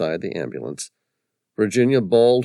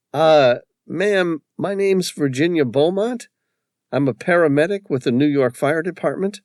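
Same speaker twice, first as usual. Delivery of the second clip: higher, careful official voice; lightly voiced paramedic on the phone; character voice; 9 semitones higher, 5 dB louder than usual. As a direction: introduce yourself politely. Paramedic answers stranger's phone.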